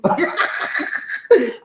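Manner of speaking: high, wheezy laughing fit. Real, not acted. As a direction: crack up at your own joke. Laughs at own Red Cross joke.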